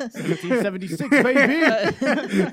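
loud, high, laughing whoop; laughing cheer. Pretend. Host jokes about American independence, loud laughing cheer. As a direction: yell it like a proud patriot.

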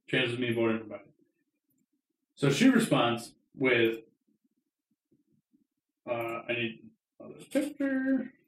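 ordinary talk, nothing marked

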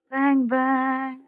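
ordinary talk

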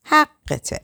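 a high, scornful jab; sneering. A narrator's reading. Bitter reproach to the man she begged, sneering. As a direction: spit it out with contempt. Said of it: "پوزخندی زد و گفت".

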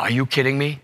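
ordinary talk, nothing marked

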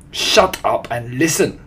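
loud, stern command; irritated witch order; snapped. A narrator's reading. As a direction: silence the crowd sharply. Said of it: "snapped the Grand High Witch".

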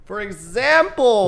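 put-on high, slow cutesy baby talk. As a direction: drag it out like a baby. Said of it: "nobody could understand your gibberish".

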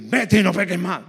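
firm, quick command at full voice; forceful exhortation. Shown as a demonstration. Preacher showing another way to exhort a sinner, forcefully. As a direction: say it hard and forcefully, no softness. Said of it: "O lo puedes decir".

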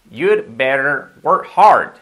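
very slow, loud teacher's demonstration; deliberate, stretched-out classroom model; slow model sentence. Shown as a demonstration. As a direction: draw each word out for learners to copy. Teacher models the phrase slowly.

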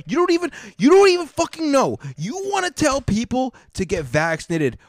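high-pitched, loud indignation; exasperated outburst. Real, not acted. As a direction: voice leaping up in outrage. Rant at TV host peaks.

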